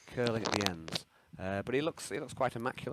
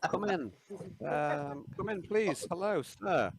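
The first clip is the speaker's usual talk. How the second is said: shopkeeper greeting; 5 semitones higher, 4 dB louder than usual. Acted. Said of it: "He says".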